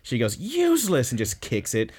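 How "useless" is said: high, scornful retold outburst; mock exasperation. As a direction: throw it out high, pretending disgust. Acts out kicking the phone aside.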